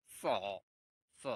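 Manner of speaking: "স" made with the upper part of the mouth. Shown as a demonstration. Teacher showing pinyin C, "স" from the upper part. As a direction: let "স" come from the upper mouth, drawn out. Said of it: "এটা মানে উপর ভাগ দিয়ে আসবে".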